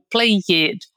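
"play it" as "play-YED", slow, stressed ending; Brazilian learner error. Shown as a demonstration. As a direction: drag out a separate "ed" syllable in "play it". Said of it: "enfatizar sempre esse E D do final". ("play it" devagar, com o "ED" final forte)

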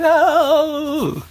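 very high cartoony "Oh!"; cartoony lady yelp. Imitating the ladies hugging each other on the train, very high yelp. Ladies climbing over the seats.

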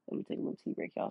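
whispered aside. Real, not acted. Aside while pausing for tea.

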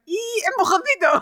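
high, pushy mock guard voice; mock guard shove. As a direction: shove her out, mockingly. Retelling pushing Siri out.

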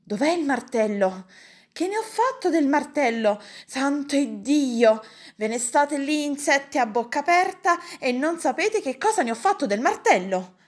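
high, loud ranting uncle; exasperated outburst. A narrator's reading. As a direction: rail at the gaping helpers. Uncle rages over lost hammer.

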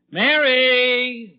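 high, loud call across the house. Acted. Husband calling his wife from the closet, loud call. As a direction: call her from another room.

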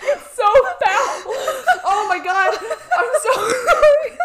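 high, slow, laughing disgust. Real, not acted. Laughing and gagging at the topic.

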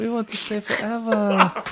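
high, slow mock Korean accent; exaggerated Korean-accented English, drawn out. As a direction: quote the guest in a high-pitched put-on Korean accent. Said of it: "Is that even a Korean accent".

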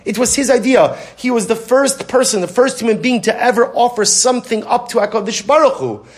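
high, impassioned sermon voice; preaching at full force. Acted. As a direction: preach it, rising with fervor. Passionate retelling, raised pitch.

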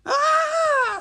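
high, loud terrified scream; woman's scream. Acted. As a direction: scream out in sudden terror. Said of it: "screaming with orange hair".

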